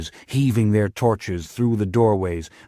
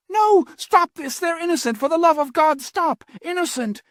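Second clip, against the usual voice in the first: desperate scream. Acted. Armand begging the hooded figures to spare his brothers, desperate scream. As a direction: scream for them to stop.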